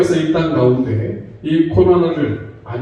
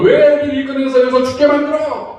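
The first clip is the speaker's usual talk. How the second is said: higher-pitched mock protest; acted resentful complaint; 6 semitones higher, faster than usual. Preacher voicing grumblers turning on Moses, acted complaining. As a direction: imitate the crowd's accusing outcry. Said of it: "그 원망하고 불평하는 자들".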